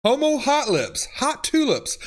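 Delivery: Homo hot lips said high and lively; amused exclaim. Real, not acted. Reading autocorrect fail aloud.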